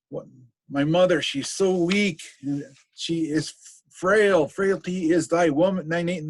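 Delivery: anguished rant. Acted. Hamlet's soliloquy about his mother.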